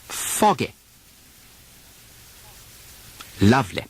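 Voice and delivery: British RP with a softened final Y; British RP demo. Shown as a demonstration. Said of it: "Repeat them after me".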